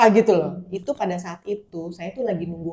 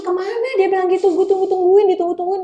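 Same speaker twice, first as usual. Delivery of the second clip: impatient friend; 12 semitones higher, 8 dB louder than usual. Retold or imitated.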